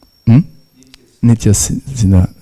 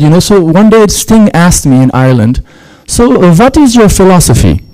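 put-on German accent; 7 semitones higher than usual. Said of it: "attempted German accent imitation".

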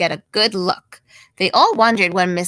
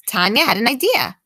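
usual speaking voice

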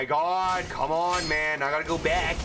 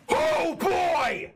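raging gamer; 10 semitones higher than usual. Real, not acted. Shouting at game death.

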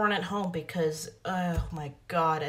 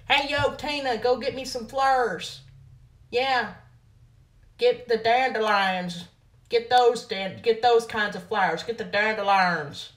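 hammed-up country accent, a bit louder; 3 semitones higher, 3 dB louder than usual. Put-on country accent asking Tina for dandelions. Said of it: "my interpretation of a country accent".

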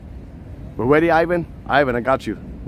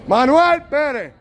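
high, drawn-out holler of a name; stretched-out high shout; street scream; 11 semitones higher, slower than usual. Shown as a demonstration. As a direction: yell it slow and high down the street. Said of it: "I'm gonna do my Manuel Perez".

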